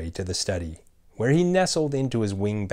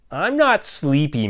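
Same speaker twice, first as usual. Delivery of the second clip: put-on high, loud child's whine; whined; 7 semitones higher, 6 dB louder than usual. A narrator's reading. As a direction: complain like a sulky little girl. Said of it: "Mabel whined".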